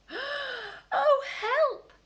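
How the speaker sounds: high, loud frightened cry; gasped in fright. Acted. Peter spotting Mister McGregor, high frightened gasp. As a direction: yelp for help in panic. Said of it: "gasped Peter".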